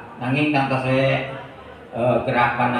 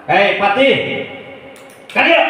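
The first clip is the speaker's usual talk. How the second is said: commanding king; 7 semitones higher than usual. Acted. King summoning his minister, high commanding shout. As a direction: call the servant over imperiously. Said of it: "Aya Kanjeng Raja".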